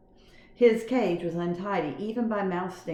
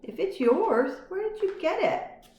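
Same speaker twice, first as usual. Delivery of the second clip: put-on curious boy; 13 semitones higher, slower than usual. A narrator's reading. Garf voiced.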